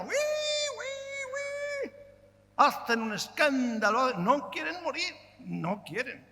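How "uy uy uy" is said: high, shrill-pitched mock squeal of a pig; pig squeal imitation. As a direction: squeal like a pig being slaughtered. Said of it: "Eso sí que gritan".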